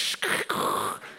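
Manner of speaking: hissing, crackling modem screech made by mouth; imitated dial-up modem noise. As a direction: mimic an old modem connecting. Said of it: "it would make these noises like".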